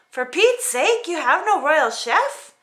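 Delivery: fed-up whine; exasperated princess. Acted. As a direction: complain in disbelief. Paulina wails.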